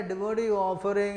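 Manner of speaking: normal talking voice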